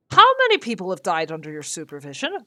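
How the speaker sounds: a hint of a gruff captain; captain's terrible accent. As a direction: rattle off the interrogation as the captain. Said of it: "Pardon for my terrible accent".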